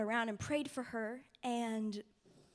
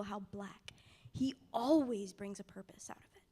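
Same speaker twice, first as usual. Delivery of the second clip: normal talking voice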